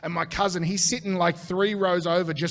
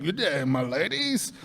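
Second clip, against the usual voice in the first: mock posh gent. Drunk gentleman farewell.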